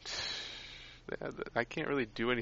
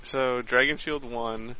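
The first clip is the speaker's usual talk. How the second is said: usual speaking voice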